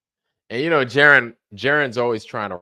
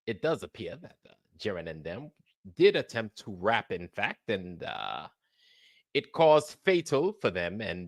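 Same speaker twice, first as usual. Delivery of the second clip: soft, lower British news anchor; mock British accent; 6 semitones lower, 7 dB quieter than usual. Studio anchor thanks the live reporter, mock British accent. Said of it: "my bullshit British accent trying to act like a British reporter".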